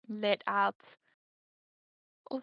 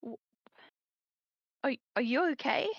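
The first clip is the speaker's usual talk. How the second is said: stuttering; 2 semitones higher than usual. Acted. Oren stammering to Akari after the vision, stuttering worried. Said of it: "kind of, like, stuttering".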